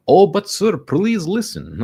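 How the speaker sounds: high, loud, begging mock Indian accent; mock Indian telemarketer begging; pleading Indian accent. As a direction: plead like the Indian caller, slowing down. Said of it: "he's like".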